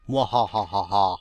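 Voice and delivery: slow low villain laugh; gloating mad scientist cackle; evil cackle. Pretend. Villain laugh after dungeon line.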